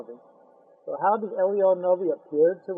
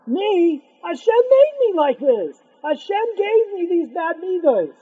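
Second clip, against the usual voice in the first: high, raised-voice mock protest; indignant self-defence; 7 semitones higher, 3 dB louder than usual. Insulted man answering the rabbi back, indignant protest. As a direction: plead your case, acting the wronged man.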